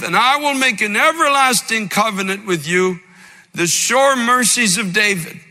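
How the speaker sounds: fervent preaching. Acted. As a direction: proclaim God's invitation like a preacher warming up. Preaches scripture with rising fervor.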